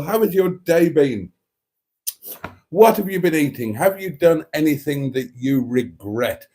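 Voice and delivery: ordinary talk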